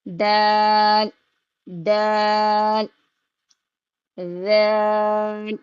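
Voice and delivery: دال held very slowly; slow drawn-out دال; stretched dal. Shown as a demonstration. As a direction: draw out دال and ذال long. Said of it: "کھینچ کے پڑھنا ہے".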